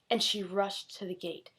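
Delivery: ordinary delivery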